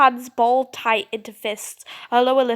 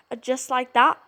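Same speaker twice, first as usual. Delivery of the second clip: ordinary talk, nothing marked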